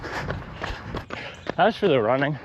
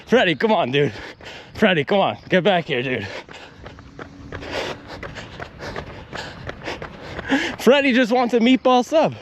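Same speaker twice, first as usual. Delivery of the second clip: higher, pleading owner calling his friend back; mock exasperated dog owner; 7 semitones higher than usual. A pretend owner calls his friend Freddy back from chasing runners, mock exasperated and higher. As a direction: whine it like a fed-up owner defending his pet. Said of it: "And I'd say".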